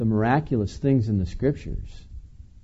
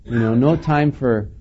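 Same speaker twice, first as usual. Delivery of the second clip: usual speaking voice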